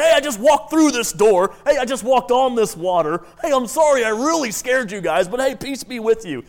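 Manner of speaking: hammed-up casual Jesus hailing the guys; comic breezy announcement. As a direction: call out cheerily to startled friends. Comic Jesus excusing his sudden appearances.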